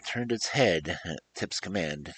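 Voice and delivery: ordinary delivery, nothing marked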